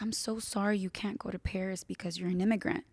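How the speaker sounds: hushed, gentle apology. Retold or imitated. Teacher consoling a pupil about the Paris trip, gentle. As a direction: break bad news softly and tenderly. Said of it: "she was very gentle with me".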